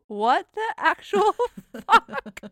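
high, loud, slow disbelief breaking into laughter; real laughing outrage, drawn out and high; incredulous. Real, not acted. Exasperated at absurd movie scene.

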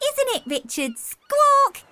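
a friendly newcomer asking to share; cheery seagull. Acted. Helen Beak again.

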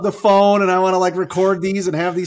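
quick, loud and high with delight; bursting to grab the phone and record it; thrilled dad gushing, fast and loud; excited gush. Retold or imitated. Delighted by son's compliments.

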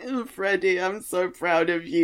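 rising into a high, crying gush; tearful praise. Retold or imitated. Proud of the character, a mock tearful gush. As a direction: get choked up as you go. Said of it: "'cause I'm like".

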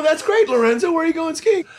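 high, excited follow-up; eager question. Retold or imitated. Quizzing Lorenzo about his ski trip, high and eager. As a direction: push the pitch up, keen and curious.

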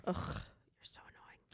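annoyed whisper. Real, not acted. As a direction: hiss it under your breath. Whispered aside to partner.

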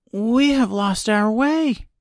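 put-on high, slow girl voice; lost girl. A narrator's reading. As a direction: explain it slowly as a young girl. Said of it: "said Dorothy".

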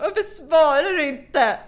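high, loud, drawn-out wail; fragile outburst. Retold or imitated. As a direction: wail a reproach, hammed-up. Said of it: "så var jag så skör". (skört, överdrivet klagande utrop)

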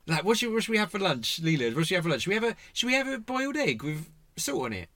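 high, mock-puzzled wondering; incredulous inner voice. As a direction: act out baffled lunch planning. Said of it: "in my head, I'm like".

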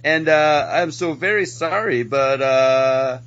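put-on sorry-to-say Canadian accent, sliding into Mexican; Canadian accent turning Mexican. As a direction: play a hesitant Canadian border official whose accent wanders toward Mexican. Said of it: "Your Canadian got very Mexican".

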